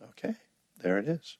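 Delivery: gently note it and let it be; quiet acceptance. Acted. Teacher breathing into a feeling, quietly accepting.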